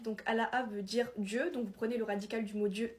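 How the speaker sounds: normal talking voice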